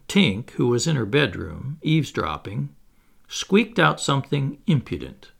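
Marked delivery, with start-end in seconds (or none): none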